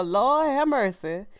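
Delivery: ordinary talk, nothing marked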